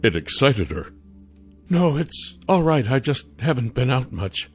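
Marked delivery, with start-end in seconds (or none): 1.7-4.5 higher character voice, easing off; reassuring Sasha. Acted. Sasha waves away the stranger's apology, reassuring. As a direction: excuse yourself in a higher voice.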